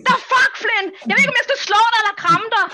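high, loud exasperation; exasperated outburst. Acted. Friend torn between hitting and hugging Flynn, exasperated outburst. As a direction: explode at a friend you missed. Climax of scolding runaway friend.

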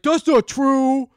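high, loud, drawn-out protest; hammed-up outraged denial, stretched out slowly; mock indignant denial. Dad denies his son's accusation, loudly hammed-up.

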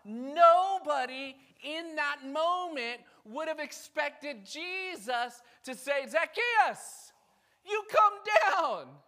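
high, loud, fervent sermon delivery; fiery preaching. Acted. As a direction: preach it to the rafters. Preaching at full force.

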